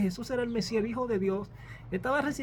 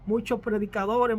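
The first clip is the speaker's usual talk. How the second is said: normal talking voice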